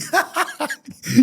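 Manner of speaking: high burst of imitated laughter; retold boss bursting into laughter; laughing. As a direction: laugh out loud as the boss did. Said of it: "And he starts laughing".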